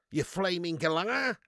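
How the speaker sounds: slow, broad Australian mock insult; Aussie impression. Streamer jokingly insulting someone, slow Australian accent. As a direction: put on an Aussie scolding, drawn out. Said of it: "Do an Aussie impression?".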